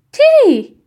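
high, loud cry of a name; protesting shout. A narrator's reading. Yann stops Tiri forcing the spoon, protesting shout. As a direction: shout the name to stop her.

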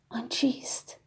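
hushed whisper. A narrator's reading. Narrator puzzling over the stranger, whispered. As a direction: whisper it, barely there.

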